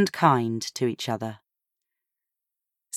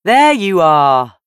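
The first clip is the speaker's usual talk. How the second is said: louder, slower call across the garden; 4 semitones higher, 9 dB louder, slower than usual. A narrator's reading. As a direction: hail someone from a distance. Ben calls out across garden.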